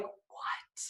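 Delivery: breathless whispered what. Retold or imitated. Teen girl whispering at a rich man's offer. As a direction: whisper it in disbelief.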